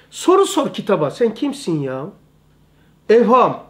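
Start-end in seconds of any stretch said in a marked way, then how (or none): none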